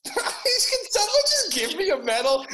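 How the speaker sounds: high, loud, half-laughing demand; exasperated plea. Real, not acted. Host begging someone to give him a medal, exasperated. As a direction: plead loudly, voice way up.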